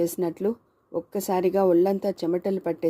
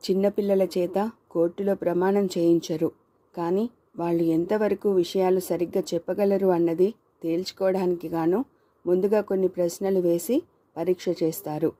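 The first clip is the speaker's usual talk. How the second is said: ordinary delivery, nothing marked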